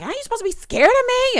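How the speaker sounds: high, loud mocking of a bratty taunt; mocking defiance. Mimicking a housemate's bratty taunt, high and loud. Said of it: "And she's like".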